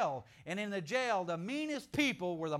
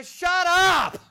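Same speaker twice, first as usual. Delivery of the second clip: much higher, full-force yell of shut up; shouted shut up; 11 semitones higher, 19 dB louder than usual. Shown as a demonstration. Preacher showing how to rebuke the curser, loud shout. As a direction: bellow shut up with all your authority. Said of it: "You want to say".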